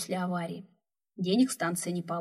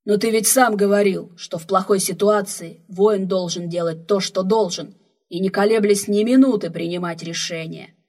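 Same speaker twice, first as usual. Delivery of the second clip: loud defiant; 10 dB louder than usual. Acted. Defiant reply to angry elder.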